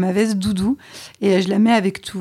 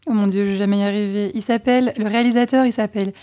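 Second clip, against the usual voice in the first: ordinary delivery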